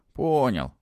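ordinary delivery, nothing marked

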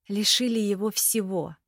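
ordinary delivery

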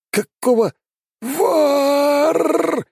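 high wailing spirit voice; eldritch horror. Acted. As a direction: shriek it like a howling void. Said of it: "продолжает вопить пустота".

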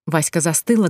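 plain reading voice

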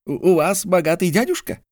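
a flicker of interest in his voice; Mordecai perks up. A narrator's reading.

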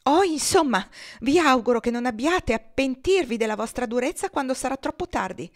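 high, loud indignant outburst; exclaiming. Acted. Maria warning her husband he'll regret it, loud and high. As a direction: fling it at him in wounded anger. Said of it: "esclamò Maria".

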